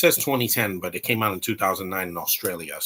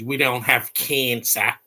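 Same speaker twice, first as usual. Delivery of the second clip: drawn-out mock New Zealand accent; slow, exaggerated parody of an Antipodean accent; Russell Crowe accent; slower than usual. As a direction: stretch the vowels in a mocking New Zealand accent. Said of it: "made fun of Russell Crowe's accent".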